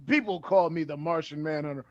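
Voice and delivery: soft, low self-introduction; solemn quiet intro. Retold or imitated. Manhunter introduces himself to Batman, soft and grave. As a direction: say your name in a hushed, grave tone. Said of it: "I'm thinking he was gonna tell him".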